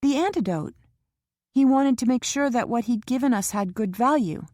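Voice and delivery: Kara anxious in the waiting room; young Kara voiced. A narrator's reading. Kara's voice in the novel.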